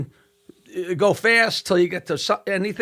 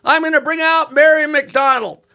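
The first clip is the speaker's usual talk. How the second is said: emcee announcement; 8 semitones higher than usual. Retold or imitated. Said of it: "He goes".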